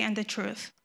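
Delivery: normal talking voice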